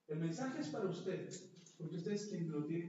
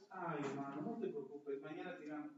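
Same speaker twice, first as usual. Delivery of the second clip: comforting sibling; 5 semitones lower, 6 dB quieter than usual. Retold or imitated. Sibling consoling a brother after a bad day, tender. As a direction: lower and softer, gentle consolation.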